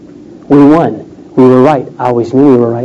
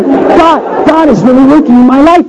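high, overwhelmed exclamation, acted out; pitched way up, marveling in a retold outburst; retold tearful amazement; 11 semitones higher than usual. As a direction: imitate someone overcome with wonder. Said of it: "She starts crying".

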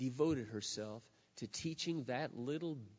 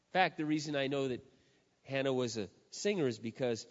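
ordinary talk, nothing marked

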